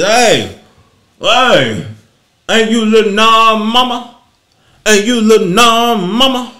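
loud, slow put-on street accent; mock accent. Man calling to [name]'s mother, loud mock accent. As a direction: imitate the man on the corner, badly. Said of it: "I'm trying to do the accent".

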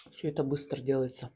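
ordinary talk, nothing marked

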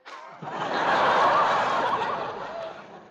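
squeaky breathless giggle; a high-pitched giggle. Acted. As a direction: giggle sheepishly. Laughs at being scolded.

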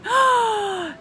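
sharp delighted gasp; high thrilled intake of breath; excited gasp. Pretend. Excited affirmation chant.

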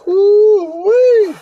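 very high "woo-wee"; whooping. Real, not acted. Reacting to the couple going viral, high hoot. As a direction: hoot in mock amazement.

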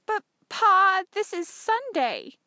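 put-on high, loud girlish plea; pleading. A narrator's reading. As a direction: plead with father, stretching the words. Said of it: "I pleaded".

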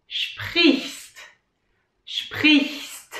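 sprichst with a hissed ch and st. Shown as a demonstration. Teacher demonstrating the hard word sprichst. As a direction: push air through sprichst. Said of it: "This is even harder".

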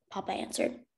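ordinary delivery, nothing marked

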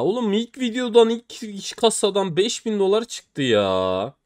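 high, frustrated complaint; exasperated outburst. Real, not acted. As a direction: vent disbelief at the bad luck. First case gave a bad drop. (yüksek perdeden isyan)

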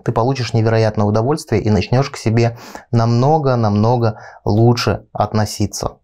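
ordinary delivery

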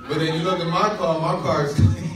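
joking brag about his clean car; laughing boast. Real, not acted. Mock boast about own car.

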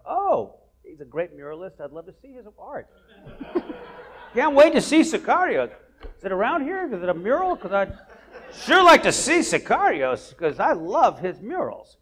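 high, chirpy mock-innocent tourist; naive tourist. Retelling to the host, a high naive tourist voice. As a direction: play the clueless tourist, eager and high.

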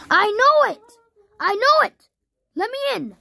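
high, urgent calls through a shut door; desperate high-pitched pleading, real and insistent; pleading to be let in. Real, not acted. Begging Roxy to open door.

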